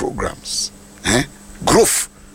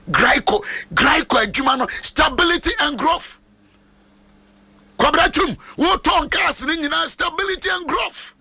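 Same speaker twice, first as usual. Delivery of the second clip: very high, ringing proclamation; prophetic blessing; 12 semitones higher than usual. Real, not acted. Pastor blessing a member who sells cars, high fervent declaration. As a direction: pitch it right up and proclaim the blessing. Blesses a car seller's business.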